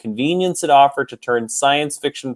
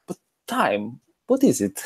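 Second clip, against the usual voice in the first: put-on Greek accent; 2 semitones higher, 4 dB quieter than usual. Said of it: "he just goes into this Greek accent".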